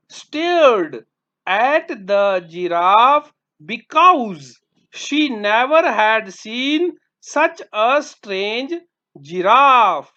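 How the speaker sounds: very slow, word by word; slow model answer. Shown as a demonstration. Teacher dictating the answer to children, very slow. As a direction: read it out slowly for dictation. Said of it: "teacher reading model answer".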